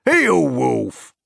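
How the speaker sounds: high, loud order to a dog; cried command. A narrator's reading. Farmer calling Wolf to heel, loud cry. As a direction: call the last dog to heel. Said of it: "he cried".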